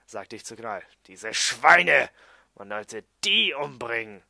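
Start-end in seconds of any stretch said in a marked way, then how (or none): 1.1-4.2 loud outraged outburst; aufgeregt, empört. Acted. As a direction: burst out in indignation, much louder. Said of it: "regte sich Gnal auf". (laut empörter Ausbruch)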